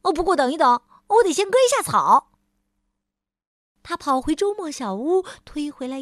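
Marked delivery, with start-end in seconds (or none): 0.0-2.3 higher, louder and fast; hurried busy voice. Acted. Mr. Busy adding he must mow, high fast and loud. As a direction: rush in the afterthought. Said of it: "他又补充说".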